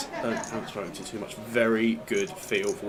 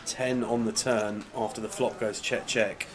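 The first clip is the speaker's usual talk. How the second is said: hushed poker commentary; 5 dB quieter than usual. Acted. Quiet play-by-play commentary.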